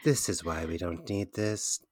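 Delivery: softly imitating a very zen teacher; chill teacher. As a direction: play the endlessly patient teacher, softly. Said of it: "she was so chill".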